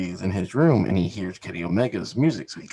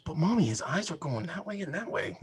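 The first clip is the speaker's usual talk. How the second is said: put-on kid voice; 8 semitones higher, 4 dB quieter than usual. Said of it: "He goes".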